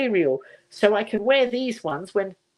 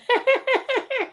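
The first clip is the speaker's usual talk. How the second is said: high delighted giggle. Real, not acted. Delighted at sparkly trousers.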